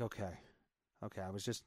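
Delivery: low, soft self-reassurance; calmed down. Retold or imitated. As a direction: settle yourself quietly after a panic. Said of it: "I eventually calm down".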